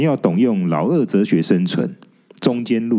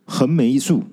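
ordinary delivery, nothing marked

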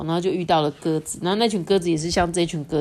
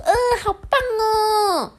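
much higher, slow squeaky cheer; cheering sparrow; 3 dB louder, slower than usual. A narrator's reading. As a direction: clap and cheer like a tiny sparrow. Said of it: "麻雀也拍手说". (拍手欢呼的小麻雀)

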